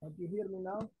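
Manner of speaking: faint, distant voice over a call; barely audible. Real, not acted. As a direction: still a bit far from the mic. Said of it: "A little bit better".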